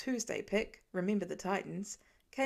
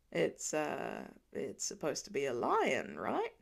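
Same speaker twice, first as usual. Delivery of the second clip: unsure guess; 6 semitones lower, slower than usual. A narrator's reading.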